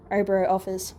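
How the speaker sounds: plain reading voice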